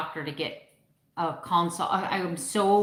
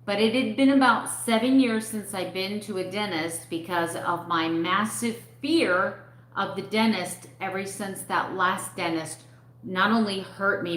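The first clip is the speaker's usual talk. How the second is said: normal talking voice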